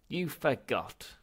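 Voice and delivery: slow, loud, high accusing snap; snapped. Acted. Ghost accusing his great-grandson, loud snap. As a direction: snap it out slowly. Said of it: "snapped Zaphod Beeblebrox the fourth".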